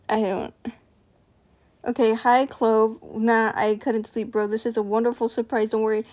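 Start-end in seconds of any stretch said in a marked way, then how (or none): none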